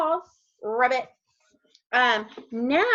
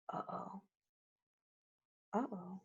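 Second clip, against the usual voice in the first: very soft, low murmur of worry; tiny, quiet note of concern; hushed uh-oh; 3 semitones lower, 19 dB quieter than usual. Pretend. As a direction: murmur it as if trouble is coming. Reader reacts to empty rock.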